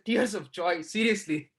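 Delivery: mock-annoyed, rising then dropping; annoyed disbelief. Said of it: "I'm really annoyed".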